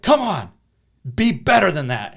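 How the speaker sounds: put-on a principal yelling at a boy; abrasive yell. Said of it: "a little abrasive".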